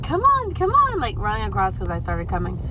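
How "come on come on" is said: high, loud call to a dog; mock call to a runaway pet. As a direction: coax the little dog over, calling high. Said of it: "She was like".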